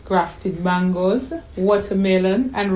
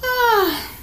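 high, startled gasp; sharp high intake of surprise; child's gasp. A narrator's reading. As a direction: gasp like a surprised little girl. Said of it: "Donna May gasped".

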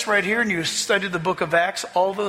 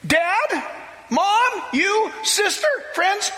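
excited plea; 12 semitones higher, slower than usual. Retold or imitated. New convert Kaha pleading with his family, high and urgent. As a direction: urge them with all your heart, voice raised. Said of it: "He said".

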